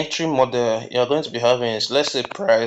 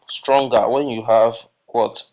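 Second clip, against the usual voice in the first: ordinary delivery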